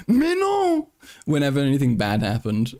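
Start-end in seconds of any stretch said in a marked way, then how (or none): 0.1-0.9 very high mock-French protest; silly French intonation. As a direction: imitate a comically dismayed French guy. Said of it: "this little mental meme of going".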